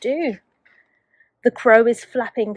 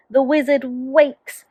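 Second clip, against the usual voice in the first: ordinary delivery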